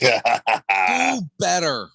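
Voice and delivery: very slow, loud "Do better"; drawn-out catchphrase. Acted. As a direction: stretch the slogan out. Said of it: "[name], can I get one?".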